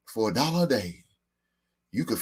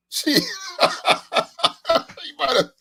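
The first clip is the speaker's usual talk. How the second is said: high, laughing through the words. Real, not acted. Laughing at his own joke about the commercials. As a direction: crack up while saying it.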